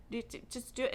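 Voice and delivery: usual speaking voice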